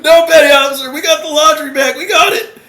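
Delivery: very high, loud squeal; frantic protest. Retold or imitated. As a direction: squeak it out in mock desperation. Recruit protests to petty officer.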